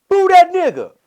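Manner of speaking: loud high heckle; mock crowd heckle; heckler shout. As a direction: yell from the back of the room.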